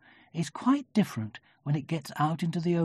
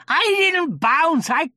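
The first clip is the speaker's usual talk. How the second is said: high, loud drawn-out protest; cross; 14 semitones higher, 9 dB louder, much slower than usual. A narrator's reading. Tigger interrupting Eeyore, cross and loud. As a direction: cut in indignantly, pitched up. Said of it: "said Tigger crossly".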